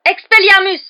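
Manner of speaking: high, explosive cry; shouted spell. A narrator's reading. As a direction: cast it at full voice. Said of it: "s'exclama-t-il". (incantation criée)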